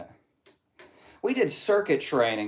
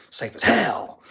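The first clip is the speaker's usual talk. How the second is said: louder, higher burst on HELL; emphatic repeat; 8 semitones higher, 6 dB louder than usual. Real, not acted. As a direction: punch "hell" high and loud.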